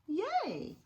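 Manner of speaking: usual speaking voice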